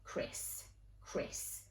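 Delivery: soft voiceless murmur of a name; inner-thought whisper. Retold or imitated. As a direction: whisper it to yourself, searching your memory. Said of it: "I thought in my head".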